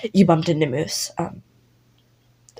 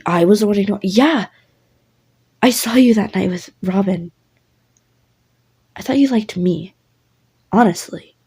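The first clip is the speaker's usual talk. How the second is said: louder, heated accusation; angry Finny; 3 dB louder than usual. Acted. Finny confronts listener about Robin, heated and loud. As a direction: let the hurt jealousy boil over. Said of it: "There's going to be an angry Finny".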